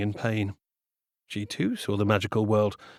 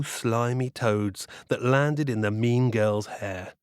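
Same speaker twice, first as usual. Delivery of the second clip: ordinary delivery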